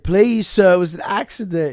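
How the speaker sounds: high loud desperate plea; pleading. Retold or imitated. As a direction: beg the coach, voice up high. Begging the coach.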